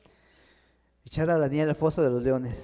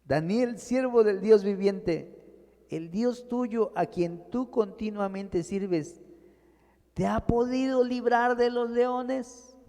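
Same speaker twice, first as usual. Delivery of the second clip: voz de rey afligido, más aguda y lenta; rey triste; 6 semitones higher, slower than usual. A narrator's reading. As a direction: pregunta con pena y poca esperanza. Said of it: "con voz triste". (el rey pregunta triste a Daniel en el foso)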